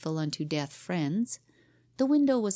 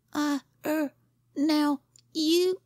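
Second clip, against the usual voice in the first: put-on much higher, halting boyish stammer; 10 semitones higher, 4 dB louder, slower than usual. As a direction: fumble for words, scared. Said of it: "stammered Jimmy".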